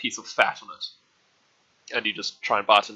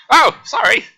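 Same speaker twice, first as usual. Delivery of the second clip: put-on startled old woman; 10 dB louder, slower than usual. Old woman drops something.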